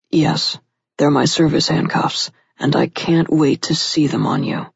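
a hint of gruff male voice; lightly gruff, wanting. A narrator's reading. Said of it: "he said gruffly".

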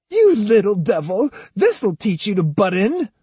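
put-on high-pitched old woman's tirade; angry scolding. A narrator's reading. Osugi slapping Jotaro for meddling, angry and high.

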